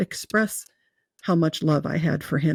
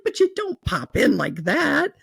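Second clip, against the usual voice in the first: slow, much higher playful reproach; teasing God, laughing; mock scolding; 9 semitones higher, slower than usual.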